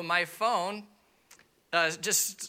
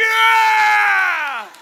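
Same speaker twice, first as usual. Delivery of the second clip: huge, high whoop of joy; ecstatic yell; 14 dB louder than usual. Retold or imitated. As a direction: shout it like you won the lottery. Thrilled at free new phone.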